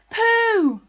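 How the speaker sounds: high, very loud hail; shout. A narrator's reading. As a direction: yell the name across a distance. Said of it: "shouted Christopher Robin".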